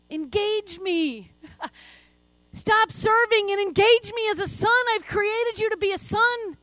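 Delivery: rising into loud, urgent pleading; prophetic voice of God. Acted. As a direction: speak as God calling his children closer, building to a shout. Speaks as God to listeners.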